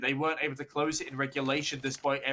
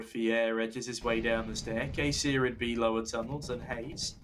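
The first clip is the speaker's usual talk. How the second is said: ordinary talk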